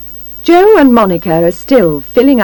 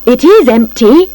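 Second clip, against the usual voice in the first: loud, higher exclamation; raised voice, lifting at the end; cry out; 4 semitones higher, 5 dB louder than usual. A narrator's reading. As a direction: cry it out in surprise. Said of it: "Missus Plunkett cries".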